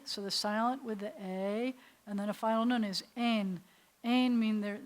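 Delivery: normal talking voice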